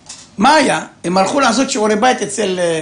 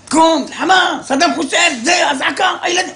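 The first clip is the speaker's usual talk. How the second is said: much higher panicked shout; panicked yelling; 10 semitones higher than usual. Retold or imitated. Wife waking husband at the siren, frantic high shout. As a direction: jolt him awake in a frantic alarm.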